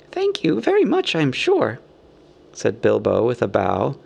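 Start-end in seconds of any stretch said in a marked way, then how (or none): 0.1-1.8 high, courteous hobbit voice; polite hobbit. A narrator's reading. Bilbo thanks the king with a bow, polite. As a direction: thank them primly, high then dropping.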